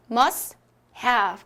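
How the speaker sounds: "must have" as "mas... hav", two separate beats; T-dropped pronunciation. Shown as a demonstration. Teacher answers her own question, T dropped. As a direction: say "must have" with no T, pause between words. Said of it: "어떻게 소리 내죠?".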